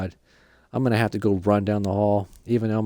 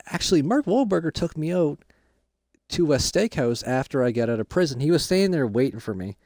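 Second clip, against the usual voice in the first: ordinary talk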